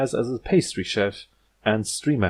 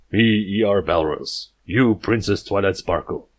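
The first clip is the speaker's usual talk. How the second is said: jungle stallion talk. Acted. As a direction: puff up and speak in blunt caveman words. Balrus introduces himself caveman-style.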